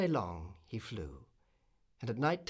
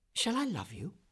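lightly voiced swallow, higher pitch; forthright swallow; 7 semitones higher than usual. A narrator's reading. Swallow to the slender reed, lightly voiced and forthright. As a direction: ask it straight out, pitched up. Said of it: "said the swallow, who liked to come to the point".

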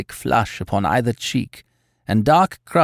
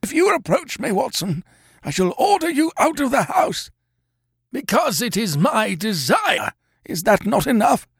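imperious sick man; 11 semitones higher than usual. Acted. Holmes commanding from sickbed.

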